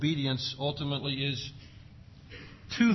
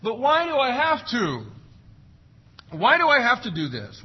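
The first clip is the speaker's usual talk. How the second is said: put-on higher, louder whiny kid voice; whining child; 8 semitones higher, 4 dB louder than usual. Preacher imitates a child whining back at parents. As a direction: whine it like a kid resisting chores. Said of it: "Children often want to ask why".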